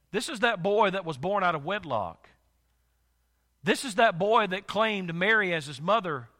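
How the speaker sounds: ordinary talk, nothing marked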